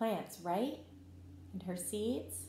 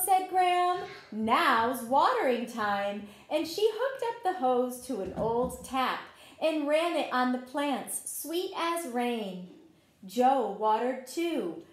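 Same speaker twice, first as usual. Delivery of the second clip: story narration; 5 dB louder than usual. A narrator's reading. Teacher reading a garden story to children, gentle read-aloud. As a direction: tell the story like a picture book at circle time. Reads picture book to children.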